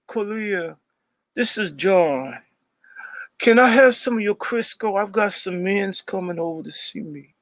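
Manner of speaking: high, lilting camp voice, teasing her; mock campy voice. Teasing birthday prank call.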